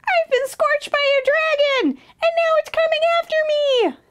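very high frightened bird voice; high-pitched storybook panic; panicked cry. A narrator's reading. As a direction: wail in alarm like a little bird. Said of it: "cried the Warbler".

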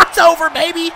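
high, excited squeal; gleeful gloating. Real, not acted. Player declaring the match won, gleeful. Premature victory celebration.

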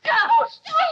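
high, loud shout; screamed order to lie on the ground; furious command. Acted. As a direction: bark it down at her sister. Sister forces her down.

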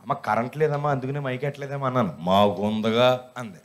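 lightly voiced lineman explaining; lineman pose. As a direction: explain the power lines like a know-all electrician. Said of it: "పెద్ద line మెన్లాగా అవతారం ఎత్తి".

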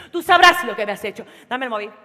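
loud, accusing, with a laugh; "sabrás" hit hard and loud; Antonio's tone. Retold or imitated. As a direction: throw the blame back at him, loudly. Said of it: "al mismo tono que Antonio".

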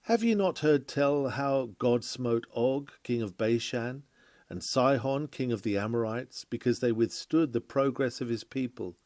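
ordinary delivery

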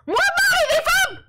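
very high, loud shriek of disbelief; outraged shriek. Real, not acted. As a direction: shriek it, aghast at the news. Shrieks at more money being found.

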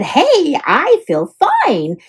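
high, slow, pleased; cheerful wake-up. A narrator's reading. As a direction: stretch into a happy morning. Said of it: "Dram woke and said".

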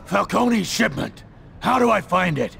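high, strained interrogating shout; forceful demand. Acted. Interrogator demanding to know about the shipment, shouting. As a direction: pitch it right up and demand an answer.

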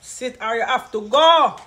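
loud slow stern order; firm slow threat, loud. Real, not acted. Ordering child to sit.

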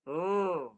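"Rrr" as a thick, held rolled r; thick Arabic ra. Shown as a demonstration. Tajwid teacher models the letter ra, thick rolled r. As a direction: roll "Rrr" heavy from the back of the tongue tip. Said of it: "Bunyi dia tebal".

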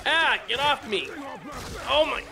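sudden high panicked shout; panicked yell. Real, not acted. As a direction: cry out as something grabs you. Attacked by a zombie in game.

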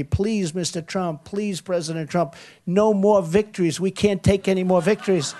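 a hint of someone begging him to stop winning. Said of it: "you're gonna be begging me".

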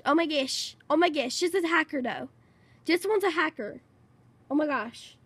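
normal talking voice

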